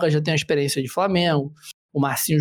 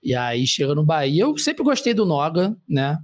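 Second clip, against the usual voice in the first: usual speaking voice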